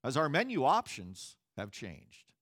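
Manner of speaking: recorded phone message voice; phone menu voice. Retold or imitated. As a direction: read it like an automated phone line. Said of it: "that simple sentence".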